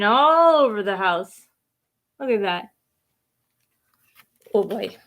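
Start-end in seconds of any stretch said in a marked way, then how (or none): none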